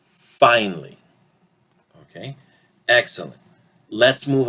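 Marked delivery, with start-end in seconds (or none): 0.1-1.0 "Finally" as two-syllable FINE-lee; reduced pronunciation. Shown as a demonstration. Teacher models it one more time, "Finally" as FINE-lee. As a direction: say "Finally" without the middle uh. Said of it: "One more time".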